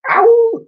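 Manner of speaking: very high loud yelp; sharp squeak of pain; chick squeal. A narrator's reading. Chick's cry.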